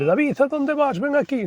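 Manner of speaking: high, anxious mother calling after her son; mother calling after her runaway son. Retold or imitated. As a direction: call him back like a worried mom. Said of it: "a mi madre".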